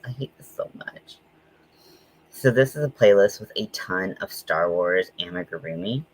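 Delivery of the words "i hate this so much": whispered, exasperated; a whispered I hate this so much; frustrated whisper. Real, not acted. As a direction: hiss it under your breath in disgust. Frustrated at video content.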